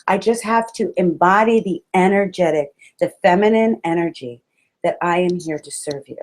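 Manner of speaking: ordinary talk, nothing marked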